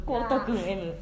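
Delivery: ordinary talk, nothing marked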